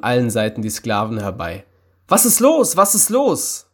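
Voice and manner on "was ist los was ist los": high, alarmed outcry; excited cry of alarm; startled questioning. A narrator's reading. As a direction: ask in a panic, voice shooting up. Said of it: "fragten sie erschrocken".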